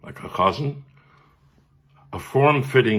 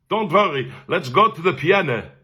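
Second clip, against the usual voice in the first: higher, reassuring Viennese teacher; Viennese accent; 6 semitones higher than usual. Retold or imitated. Teacher leading pupil to the piano, Viennese accent. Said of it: "He was my Viennese cantorial teacher".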